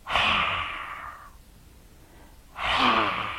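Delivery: long voiceless blast of breath; breathy whoosh of dragon fire. Acted. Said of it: "and he went".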